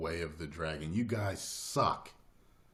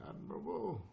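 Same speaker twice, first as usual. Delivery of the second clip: movie quote; 10 semitones higher, 8 dB quieter than usual. Retold or imitated. Reciting a favorite line.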